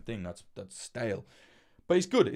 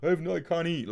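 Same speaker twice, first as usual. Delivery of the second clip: high, loud mock whine of a broke guy; overdone sob-story whine, pitched high; mock pleading; 9 semitones higher, 6 dB louder than usual. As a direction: ham up a helpless starving beggar. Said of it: "I'm not like".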